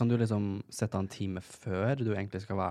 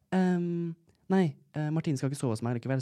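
hesitant friend; 6 semitones higher than usual. Retold or imitated. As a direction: stall awkwardly before the excuse, pitched up. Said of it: "sender Rebecca". (nølende etterligning av venninne)

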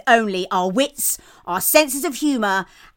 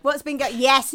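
ordinary talk

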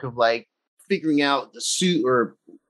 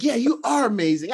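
raised, excited answer to the screen; excited fan reply; excited cheer; 7 semitones higher than usual. Retold or imitated. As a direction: cheer it back, pitched up. Excited reaction to movie line.

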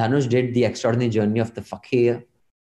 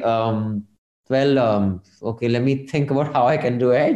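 ordinary delivery

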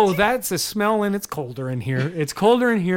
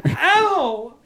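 yelp of pain; 10 semitones higher, 4 dB louder than usual. Acted. Being put down roughly.

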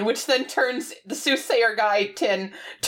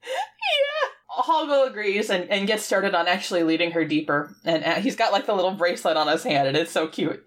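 normal talking voice

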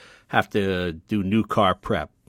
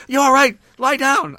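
much higher and louder, alarmed shriek; panicked high cry; 10 dB louder than usual. Retold or imitated. Startled driver to bumped Leno, panicked high cry. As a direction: cry out in fright as if someone is bleeding.

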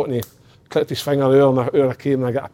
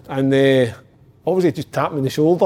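normal talking voice

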